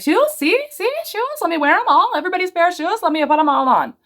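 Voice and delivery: put-on high, eager little kid voice; toddler voice. Imitating her son grabbing everyone's shoes, high and eager. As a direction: babble like a shoe-crazed toddler. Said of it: "He's like".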